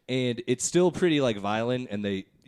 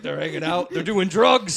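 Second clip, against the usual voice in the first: a dad getting alarmed, higher and louder by the end; mock-outraged father, climbing; 6 semitones higher, 7 dB louder than usual. Dad complaining about the cartoon, growing alarm.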